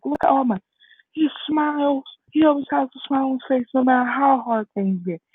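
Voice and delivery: high-pitched, eager argument; earnest insistence. Retold or imitated. Deku telling his mother about his smiling idol, earnest. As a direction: act out a boy defending his idol. Deku pleads All Might's example.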